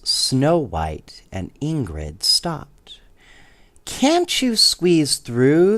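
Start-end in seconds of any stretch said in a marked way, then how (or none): 3.8-5.8 high, slow doll voices; sweet little dolls asking gently. A narrator's reading. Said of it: "they asked".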